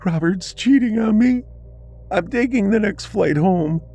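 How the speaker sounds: high, sobbing voice breaking; tearful daughter. A narrator's reading. Daughter tells her mother on the phone, crying. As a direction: choke it out through tears. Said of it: "soft sobbing of her daughter".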